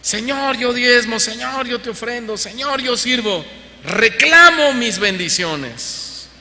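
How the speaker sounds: high, pushy voice of a believer demanding blessings; demanding complaint to God. Retold or imitated. Preacher mimics believers demanding blessings from God. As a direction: mimic a churchgoer listing what he's owed. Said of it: "Otros le reclaman a Dios".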